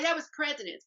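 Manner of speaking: usual speaking voice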